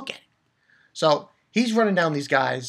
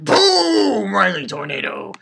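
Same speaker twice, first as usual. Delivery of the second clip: attack shout; 3 semitones higher, 6 dB louder, slower than usual. Acted. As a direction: yell the move like an anime hero. Acting out attack call.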